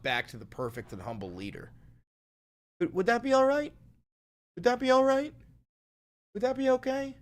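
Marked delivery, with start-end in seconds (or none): 2.8-7.1 high, sweetly mock-polite asking; fake-innocent little voice asking permission; teasing the chat with sugary politeness.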